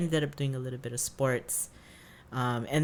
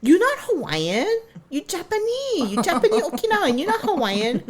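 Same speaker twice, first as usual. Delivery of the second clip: high, loud sing-song teasing; taunting brother; 9 dB louder than usual. Retold or imitated. Big brother taunting sister she's Japanese, high loud mockery. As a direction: rub it in like a bratty big brother. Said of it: "he's like".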